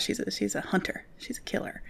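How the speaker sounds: whispered, menacing hush; dramatic whisper. Real, not acted. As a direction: breathe it like a secret threat. Dramatic hushed praise of hunter cat.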